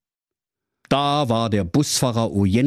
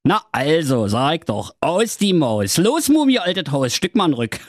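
high brash swaggering call; cocky prince voice; 6 semitones higher than usual. Acted. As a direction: taunt the mummy like a vain show-off. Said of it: "Und der Prinz rief".

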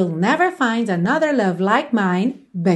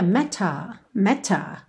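British "matter" with a crisp t; British accent; 3 semitones lower, 4 dB quieter, slower than usual. Shown as a demonstration. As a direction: say "matter" the British way, t fully released. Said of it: "Num sotaque mais britânico".

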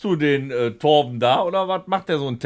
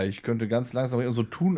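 ordinary talk

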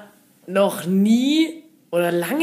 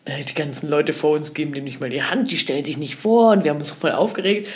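ordinary talk

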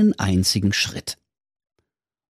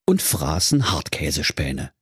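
plain reading voice

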